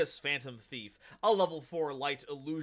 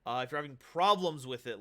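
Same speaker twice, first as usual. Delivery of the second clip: normal talking voice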